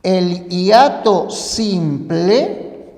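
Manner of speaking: slow, deliberate naming of the term. Shown as a demonstration. Teacher slowly names the first type for students, deliberate. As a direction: spell out the term word by word for the class. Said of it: "existen dos clases".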